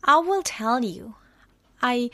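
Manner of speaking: ordinary talk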